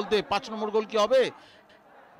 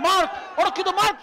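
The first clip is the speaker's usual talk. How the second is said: rising excitement; 8 semitones higher than usual. Real, not acted. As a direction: pitch climbing high. Chance building.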